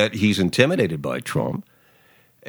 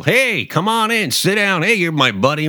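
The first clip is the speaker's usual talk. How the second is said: schmoozing mogul; 9 semitones higher than usual. Retold or imitated. Host imitates Trump welcoming Comey as a back-slapping mogul. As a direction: welcome a guest like a New York City real estate boss. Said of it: "a New York City real estate, uh, mogul".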